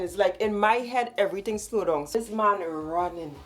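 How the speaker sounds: usual speaking voice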